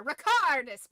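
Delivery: Harry Potter spell. Pretend. Reading nicknames as a spell, loud mock wizard cry. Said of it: "some Harry Potter".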